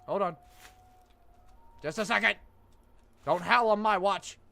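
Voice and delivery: loud high yell; shouting. Pretend. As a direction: bark it out loudly. Yelling at howling creature.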